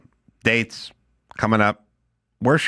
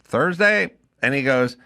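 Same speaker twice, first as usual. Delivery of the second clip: ordinary talk, nothing marked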